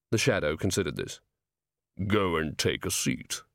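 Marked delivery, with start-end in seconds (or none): 2.1-3.4 low, slow creature barman; deep rolling voice. A narrator's reading. Shadow sends Angua to a seat, deep and rolling. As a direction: rumble it out slowly, deep and low. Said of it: "in a deep, rolling voice".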